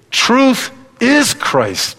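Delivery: slow, high "truth is Christ" with weight on "is"; emphatic declaration. Real, not acted. Preacher answering "what is truth" for the congregation, slow and emphatic. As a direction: lean on "is" and stretch every word.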